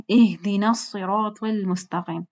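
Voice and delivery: "الصراط" with heavy s and long vowels; careful recitation. Shown as a demonstration. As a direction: slowly sound the h of "اہدنا" and full "الصراط". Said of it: "اس کو ہم لوگ سی پڑھتے ہیں". ("الصراط" موٹے صاد سے، ٹھہر کر)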